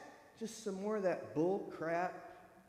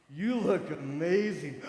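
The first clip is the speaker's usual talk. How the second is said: slow, low, manly praise; imitating a man's smooth compliment; deep male compliment; 5 dB louder, slower than usual. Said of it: "could look at you and go".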